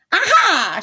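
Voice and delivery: high, loud eureka shout; excited shout. Acted. Witch struck by a sudden idea, high shout. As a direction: leap on the idea. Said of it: "she shouted with a jump".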